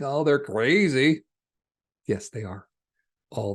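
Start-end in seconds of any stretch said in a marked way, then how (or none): 0.0-1.2 much louder, slow, dismissive; scoffing judgment. Retold or imitated. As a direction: sneer at them as crazy. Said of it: "So when you think".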